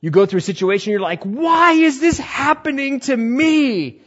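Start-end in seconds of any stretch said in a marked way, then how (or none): 1.2-4.0 loud, high, drawn-out mock wail; hammed-up anguished cry. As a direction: wail it slowly to the heavens. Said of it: "And you're crying".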